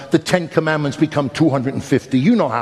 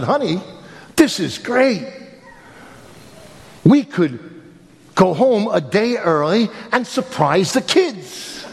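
higher, laughing, eager husband; giddy husband telling wife his plan; excited quote; 6 semitones higher, slower than usual. Retold or imitated. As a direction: sell the surprise plan gleefully.

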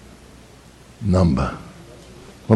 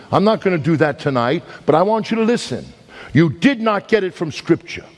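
higher, rising preacher's cry; preaching at full force; 8 semitones higher than usual. Acted. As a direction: drive it home to the congregation.

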